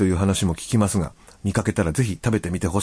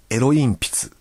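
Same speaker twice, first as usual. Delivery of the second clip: Niigata dialect swapping i and e in "エロ鉛筆"; Niigata accent; 3 semitones higher, much slower than usual. Shown as a demonstration. Radio host demonstrating a listener's Niigata example, Niigata accent. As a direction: say "エロ鉛筆" Niigata-style, as "ero-inpitsu". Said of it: "新潟弁でなまって言うと".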